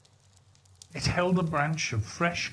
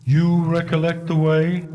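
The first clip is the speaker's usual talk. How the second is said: spirit's question; 2 semitones higher, 8 dB louder than usual. Acted. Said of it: "inquired the spirit".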